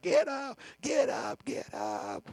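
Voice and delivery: a hint of a drill sergeant yelling; lightly voiced sergeant rousing recruits. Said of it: "Drill sergeants running down the hallways".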